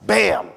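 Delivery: loud sudden bang sound; mock bang. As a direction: smack the word out like an impact. Mom hits head, sound effect.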